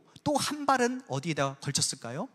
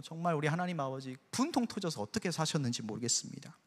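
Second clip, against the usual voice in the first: normal talking voice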